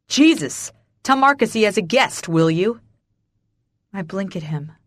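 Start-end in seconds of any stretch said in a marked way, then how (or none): 0.1-2.8 raised, impatient voice; louder, higher, fed-up command; exasperated order. A narrator's reading. Irritated father orders the visitor.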